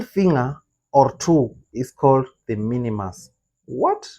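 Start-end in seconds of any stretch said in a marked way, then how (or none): 3.7-4.1 sudden high squeak of disbelief; surprised. Real, not acted. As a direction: react like you can't believe it. Surprised at the word minimus.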